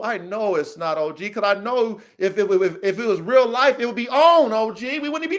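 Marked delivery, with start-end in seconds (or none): none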